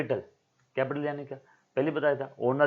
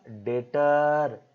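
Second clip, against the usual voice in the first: drawn-out answer; 2 semitones higher, 4 dB louder, much slower than usual. Shown as a demonstration. Teacher draws out the answer.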